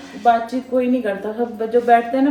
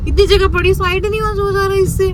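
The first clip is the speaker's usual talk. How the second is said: higher, louder irritated complaint; annoyed at someone blocking the road; 8 semitones higher, 5 dB louder than usual. Real, not acted. Annoyed in traffic. (गाड़ी के आगे चल रही लड़की पर झुंझलाहट)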